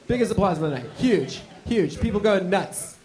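ordinary talk

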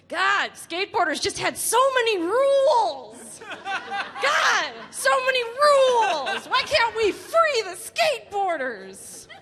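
high, exasperated rant with drawn-out endings; loud, high-pitched comic protest; mock outrage. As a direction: wail it in hammed-up frustration. Mock outrage at skateboarding rules.

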